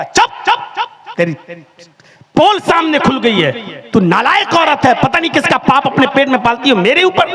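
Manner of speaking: high, sharp chup chup; mock-furious husband silencing her; angry hushing. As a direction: cut her off in triumph. Driver silencing lying woman.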